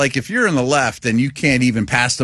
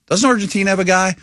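pitched way up, eager and unsure; hopeful dad question; 9 semitones higher than usual. Retold or imitated. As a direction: ask it like a clueless dad reaching for a fact. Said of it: "I'm like".

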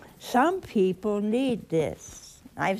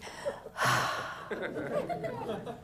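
long breathy sigh of relief, laughing; relieved sigh; 14 semitones higher, 8 dB quieter than usual. Shown as a demonstration. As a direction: let out a big relieved breath. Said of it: "She breathed one of these".

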